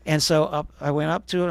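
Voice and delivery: ordinary delivery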